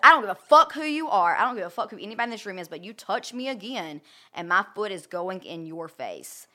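loud burst that slows to a deliberate threat; retold fury. Retold furious outburst.

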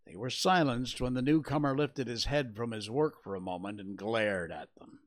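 ordinary delivery

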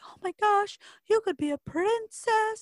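high, loud, drawn-out mock squeal of excitement; excited sister. May urging her sister to be a princess, excited. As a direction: gush it high and loud, imitating a thrilled sister.

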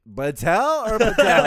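high, very loud, drawn-out repeat, laughing; baffled loud question. Retold or imitated. Clerk double-checking the caller's surname, loudly baffled. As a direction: stretch both names out in laughing bafflement.

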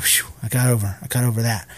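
low, soft sigh of relief; mock relief. Fake scolders once onlookers leave, relieved. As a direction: let it go quietly, voice dropped.

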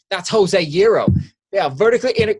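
hammed-up, unsteady American Southern accent; wandering Southern accent. Mocking an actor whose accent keeps changing, exaggerated Southern accent. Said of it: "his accent changes at least seven times".

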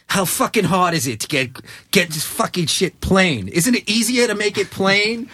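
exasperated fuming complaint; angry rant. Retold or imitated.

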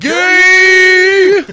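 loud, high teasing shout. Real, not acted. Ribbing his co-host over the toilet joke, loud high shout.

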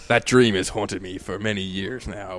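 slow, low mock-solemn monologue; hammed-up tragic backstory voice; dramatic brooding hero. Hamming up a game intro.

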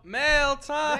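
high, loud announcer shout; excited shout. Real, not acted. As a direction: call out the segment gleefully. Announcing segment, calling out.

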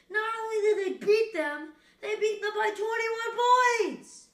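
high, stretched-out disbelief; like you can't believe the score. Real, not acted. To viewers, shocked by the result, high disbelief. Stunned that the Niners won.

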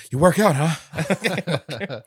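slow, breathless, laughing half-gasp; puffing after running up stairs; out of breath. Retold or imitated. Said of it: "he's tired as fuck halfway through".

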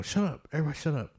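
imitating someone silencing friends; retold urgent hushing.

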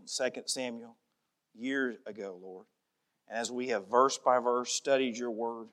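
soft, low prayer voice; hushed pastoral prayer. Acted. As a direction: pray humbly to God. Pastor leads closing prayer.

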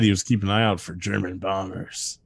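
ordinary talk

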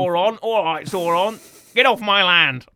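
loud, hammed-up Cornish farmer shout; Cornish farmer accent. Podcaster as an orc seeing off Sauron, loud mock Cornish farmer accent. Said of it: "don't they sound like Cornish farmers".

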